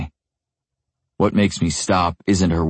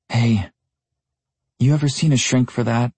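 higher, casual airy woman's voice; light and airy; 7 semitones higher than usual. Acted. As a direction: ask it lightly, no big deal. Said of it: "she asks in a light, casually airy voice".

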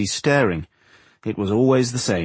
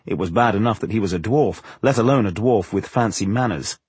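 plain reading voice

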